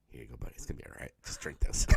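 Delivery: soft whisper, coaxing; low whispered here you go, buddy; hushed. Pretend. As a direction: whisper it gently like comforting a drunk. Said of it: "and go".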